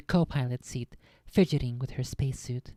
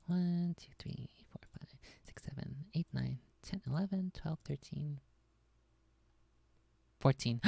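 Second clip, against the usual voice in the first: under breath; 9 dB quieter than usual. Real, not acted. Counting pages under his breath.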